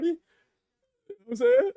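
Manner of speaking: very high laughing question; laugh-cry squeal. Real, not acted. Streamer asking friend Irie, high laugh-squeal.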